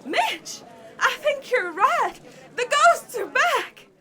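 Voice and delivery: high, loud, slow cry of alarm; a frightened villager crying out to his friend; alarmed shout. Acted. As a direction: raise the alarm in panic. Townsfolk alarm about the ghosts.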